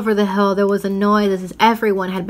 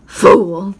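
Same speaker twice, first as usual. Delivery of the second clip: louder contemptuous snarl; snarling witch; 7 dB louder than usual. A narrator's reading. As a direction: spit the word with a savage smile. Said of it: "savage smile that was almost a snarl".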